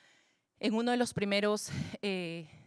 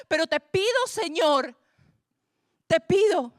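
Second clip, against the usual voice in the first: pleading prayer; 6 semitones higher, 8 dB louder than usual. Acted. Preacher prays aloud to God.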